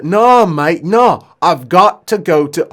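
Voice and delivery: loud, high British interruption; British accent. Acted. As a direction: cut in as the protesting British patient. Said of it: "maybe he is British".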